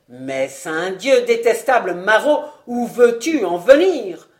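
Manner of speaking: building to a loud furious shout; exasperated. Acted. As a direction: lose your temper completely. Vaunois exasperated by Lapierre. (colère qui monte jusqu'au cri)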